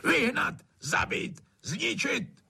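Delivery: high-pitched bellowed orders, one word at a time; strained, raging commands; bellowing. A narrator's reading. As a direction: shout them out over a din. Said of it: "hulákal, aby přehlušil žabí zpěv".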